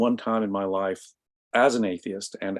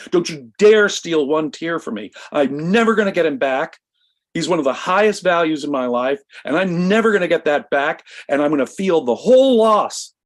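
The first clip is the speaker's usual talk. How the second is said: higher and louder, a furious tirade re-lived; retold angry outburst; 7 semitones higher, 5 dB louder than usual. Brother to the hospital clergyman, a retold furious higher louder outburst. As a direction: let the grief boil over into anger, voice high and loud. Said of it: "I got very angry and went off on him".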